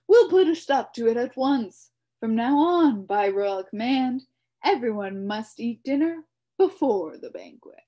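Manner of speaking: loud, high commanding king; royal decree. A narrator's reading. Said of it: "shouted the king".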